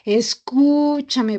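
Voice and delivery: "Escúchame" with the "ú" held long, pleading complaint; slow reproach, long "ú" in "Escúchame"; drawn-out U. Acted. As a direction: stretch "Escuuúchame" slowly at someone not listening. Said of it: "a veces alargamos la U".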